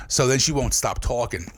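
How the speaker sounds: ordinary delivery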